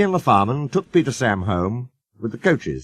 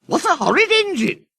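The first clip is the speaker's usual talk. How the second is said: very high indignant coach voices; shrill coaches. A narrator's reading. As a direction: squeal it in indignation. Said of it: "screamed Agnes".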